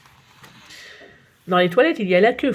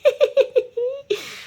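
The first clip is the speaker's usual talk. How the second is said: high giggling laugh; child's giggle. Acted. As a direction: giggle like a cheeky boy. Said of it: "ça fait bien rigoler Nicolas". (Nicolas rigole, rire aigu d'enfant)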